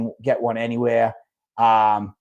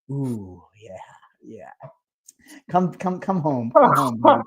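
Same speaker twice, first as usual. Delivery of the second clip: mock blissful welcome; slower than usual. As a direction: savour the sip and beg it to come home. First sip, welcoming Monster back.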